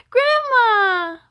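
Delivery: put-on high, loud, joyful cry of a child spotting grandma; little boy thrilled to see grandma at the door, excited shout; shouted excitedly. A narrator's reading. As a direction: burst out with delight. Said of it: "B J shouted excitedly".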